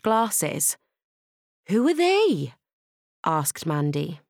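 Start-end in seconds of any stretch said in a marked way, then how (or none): 1.7-2.5 high-pitched schoolgirl question; high, curious pupil; Mandy's question. Acted. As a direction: ask high, in a girl's voice. Mandy asks about strangers.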